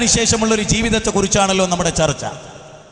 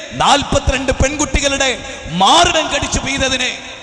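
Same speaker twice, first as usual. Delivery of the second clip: impassioned preaching; 6 semitones higher than usual. Real, not acted. Preacher denouncing scandals before the gathering, full-force preaching. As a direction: thunder accusations, drawing out line endings.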